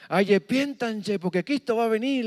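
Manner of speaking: a small girl playing pastor, pleading; little sister preaching to her brothers. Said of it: "les comenzaba a predicar".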